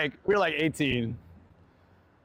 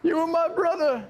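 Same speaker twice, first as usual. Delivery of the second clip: mock betrayed girlfriend; 14 semitones higher than usual. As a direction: play a wounded girlfriend over the top. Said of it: "she was so angry".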